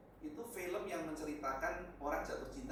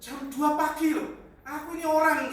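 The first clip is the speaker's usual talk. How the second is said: exasperated outburst; 12 semitones higher, 16 dB louder than usual. Real, not acted. Pastor protests to the congregation about a 2 a.m. call, loud and exasperated. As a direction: throw up your hands and belt it out.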